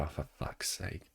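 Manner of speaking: half-muttered, slowed exasperation; exasperated mutter. Retold or imitated. Retold relief after police joke.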